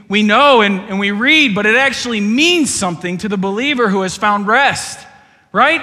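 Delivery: loud, high preaching voice; full-force preaching. Acted. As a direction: preach it hard to the whole room.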